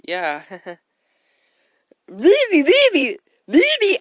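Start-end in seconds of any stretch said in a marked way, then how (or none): 2.1-4.0 put-on bratty kid. Kid whining at his dad in Costco. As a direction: play a spoiled shrieking kid. Kid chanting baby again.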